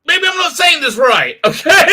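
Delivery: high, loud hammed-up interviewer, laughing; mock interviewer. Streamer voicing the interviewer rephrasing the question, laughing. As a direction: ham up the flustered interviewer through laughter. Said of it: "Julian Bashir is like".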